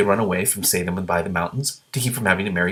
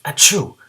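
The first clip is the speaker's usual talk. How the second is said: big hammed-up dragon sneeze; loud sneeze; 6 semitones higher, 7 dB louder than usual. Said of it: "Every time the gray green, green dragon sneezed".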